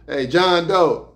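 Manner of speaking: slow, drawn-out call across the room; calling out a name. Retold or imitated. Guard calling each inmate's name, drawn-out call.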